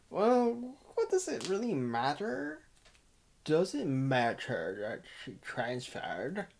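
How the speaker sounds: odd affected voice, swooping in pitch; soft put-on voice, questions curling upward; put-on silly voice. Narrator musing on the transfer, in a put-on voice. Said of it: "I don't know why I did that voice".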